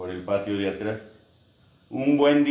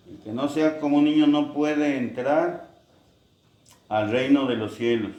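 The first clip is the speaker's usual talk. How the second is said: higher, louder, pressing the words home; preacher voicing Jesus, forceful and higher; emphatic proclamation; 5 semitones higher, 4 dB louder than usual.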